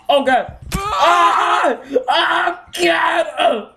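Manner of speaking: high panicked yelps of fright; panicked scream. Real, not acted. Gamer screaming in panic as the fight goes wrong. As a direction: shriek in real panic.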